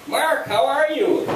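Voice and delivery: high, drawn-out greeting; cheery retold hello; retold bubbly greeting.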